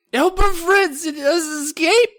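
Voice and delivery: very high, slow little gnome voice; slow squeaky gnome. Acted. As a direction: draw it out like a tiny whining gnome. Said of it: "Chip replied".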